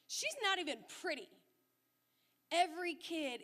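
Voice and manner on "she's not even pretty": higher, louder jeering shout; mock loud yell. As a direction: retell the boy's mocking yell across the room. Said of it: "really loud at the top of his lungs".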